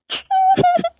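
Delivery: very high, breaking cry; girl sobbing awake from a nightmare; frightened sob. Acted. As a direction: let out a sudden scared sob. Girl wakes from the nightmare crying.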